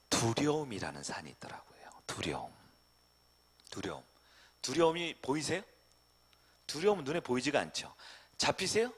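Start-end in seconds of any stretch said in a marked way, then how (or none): 0.1-4.0 hushed, low whisper. Real, not acted. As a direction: drop to a low whisper on fear. Preacher hushes on fear.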